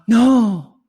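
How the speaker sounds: high, loud, drawn-out mock "no"; mock shocked no. Cohost imitating her reaction to not getting married, mock shock. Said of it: "she went".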